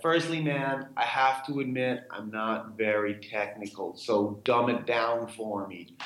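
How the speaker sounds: put-on slow, poor American accent; badly faked American accent, slowing down as it goes; Woody Harrelson impression. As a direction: imitate a laid-back American, drawing the words out. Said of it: "this is my poor American accent".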